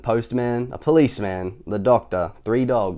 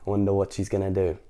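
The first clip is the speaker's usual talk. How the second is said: usual speaking voice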